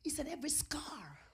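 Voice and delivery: very quiet, pained confession; hushed testimony. Real, not acted. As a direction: say it softly, almost whispering.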